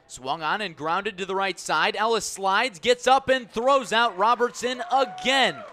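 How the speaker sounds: building radio call of a robbery; excited play call. Real, not acted. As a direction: drive the ground ball to the out. Live call of ground out.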